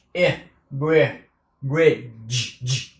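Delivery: "bridge" ending on a clear j sound; final j sound. Shown as a demonstration. Teacher showing the final j sound of bridge. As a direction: land the j at the end of "bridge". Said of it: "Âm cuối là j".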